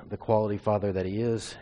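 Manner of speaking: usual speaking voice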